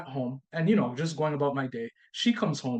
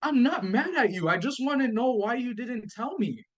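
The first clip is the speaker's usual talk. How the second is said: defensive disbelief; 7 semitones higher than usual. Retold or imitated. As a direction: insist you're not angry, pitched up. Defending himself to his wife.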